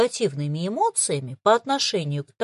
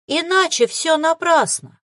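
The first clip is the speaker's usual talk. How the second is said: despairing; 8 semitones higher, 5 dB louder than usual. A narrator's reading. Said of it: "с отчаянием в голосе".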